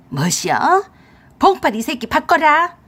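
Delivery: loud, high, outraged demand; angry older woman's shout; fierce mother-in-law. Acted. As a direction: demand him on the phone, furious. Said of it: "시어머니 앙칼진 목소리를 듣더니".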